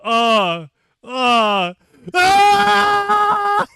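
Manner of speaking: hesitant grunts rising to a high loud yelp; stammer, then shriek in fright; mock startled soldiers. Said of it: "they're like".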